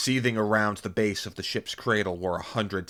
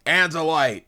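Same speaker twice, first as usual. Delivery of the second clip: high, loud exclamation of delight; surprised, greedy cry; 8 semitones higher, 8 dB louder than usual. A narrator's reading. Hork-Bajir spotting his prey, surprised delighted cry. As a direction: cry out at an unexpected prize. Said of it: "in surprise and greedy delight".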